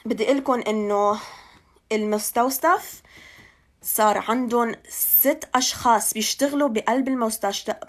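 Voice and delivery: ordinary talk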